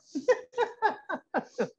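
high, light laugh in descending bursts; amused laugh. Real, not acted. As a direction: laugh at your own generous measuring. Laughs at adding more again.

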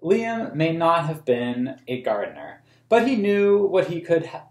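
put-on reading a picture book aloud to children; storybook reading for kids; story narration. A narrator's reading. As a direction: tell the story in a clear, even read-aloud voice. Reads the book's text aloud.

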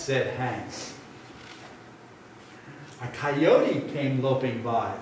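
ordinary delivery, nothing marked

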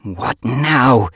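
loud, exasperated growl; irritated growl. A narrator's reading. Yellowfang summoned to clan meeting, irritated growl. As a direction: growl it as if fed up. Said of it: "she growled".

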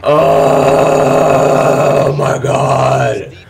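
high, loud, drawn-out wail with laughter; laughing outcry. Real, not acted. Gamer howling at a disastrous play. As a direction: stretch it into a long laughing shout.

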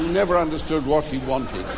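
ordinary delivery

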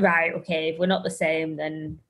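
menacing Ginger. Retold or imitated. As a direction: drop down dark as the sister turns. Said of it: "It's like".

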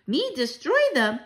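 put-on high, slow, disbelieving child; astonished cry. A narrator's reading. Mouse grandchild given a huge task, astonished cry. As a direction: draw out the question high, in disbelief. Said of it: "I cried".

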